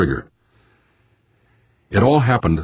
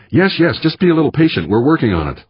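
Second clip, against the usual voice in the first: impatient placating; 9 semitones higher than usual. A narrator's reading. Tense skipper placating the crew boss.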